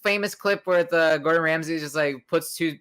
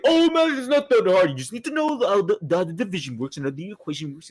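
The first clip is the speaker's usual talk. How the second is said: mocking know-it-all; 2 semitones higher, faster than usual. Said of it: "continuously say they're like".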